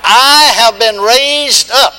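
loud, high, very slow call for the crowd to repeat; preaching call. Acted. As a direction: chant it out line by line. Call and response.

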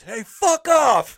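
hammed-up panicked shout at a mountain lion; mock yell. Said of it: "he goes".

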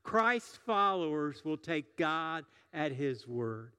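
slow emphatic sermon line; slow, deliberate declaration. Shown as a demonstration. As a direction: spell out the key sentence slowly. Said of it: "One more time".

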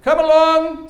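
high, loud call across a foggy hillside; shepherd calling out. Retold or imitated. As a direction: call the flock in from far away, imitating the shepherd. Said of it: "he starts shouting out".

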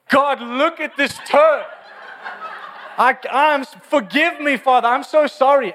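high, loud, slow hammed-up begging; mock pleading prayer. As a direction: whine forgiveness like a guilt-ridden believer.

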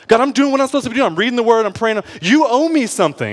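high, loud, rapid-start complaint to God; retold indignant prayer; entitled demand. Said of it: "that's when I, I was approaching God".

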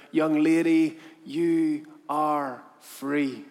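very slow, word-by-word pronouncement; solemn declaration. Acted. Buyer tells the slave girl her fate, slow and solemn. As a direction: let each word land with weight.

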